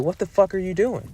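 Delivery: high-pitched incredulous demand; acted-out bewildered question; retold disbelief. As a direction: retell a baffled challenge, pitch jumping up. Acted confrontation with woman undressing.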